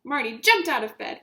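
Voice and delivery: plain reading voice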